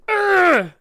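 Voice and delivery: loud, high, strained grunt; mock possessed cry. Possessed girl in the horror scene, hammed-up cry. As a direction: throw out a sudden exaggerated "ugh".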